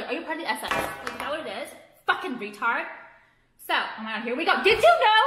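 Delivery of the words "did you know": much higher and louder taunting question; mocking voice. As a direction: taunt them like a know-it-all. Mocking critics again.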